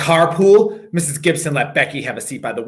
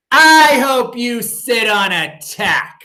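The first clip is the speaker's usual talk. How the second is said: high, louder and slow spiteful wish; spiteful retort; 8 semitones higher, 5 dB louder, much slower than usual. Acted. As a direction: spit it at Paul, slowly. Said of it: "I said to Paul".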